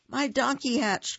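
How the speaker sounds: high, excited cry; cried out. Acted. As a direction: shout it with joy. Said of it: "cried Bear".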